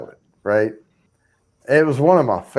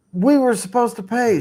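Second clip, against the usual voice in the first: much higher pitch, incredulous; retold worried protest; 11 semitones higher than usual. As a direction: re-enact your alarmed objection. Acts out his worried protest.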